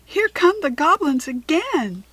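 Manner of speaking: urgent warning shout; alarmed call. A narrator's reading. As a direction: warn them the goblins are back. Calling out a warning.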